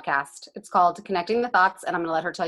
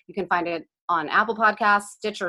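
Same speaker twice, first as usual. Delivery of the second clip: normal talking voice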